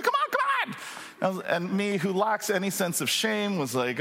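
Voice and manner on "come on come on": much higher, squeaky pleading friend. Retold or imitated. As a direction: squeak it out like a wacky buddy begging. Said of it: "he had this high squeaky voice".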